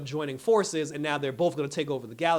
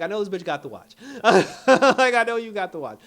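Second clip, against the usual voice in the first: high squealing laughter; laughing; 7 semitones higher, 12 dB louder than usual. Real, not acted. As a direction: laugh through the words. Laughing while quoting herself.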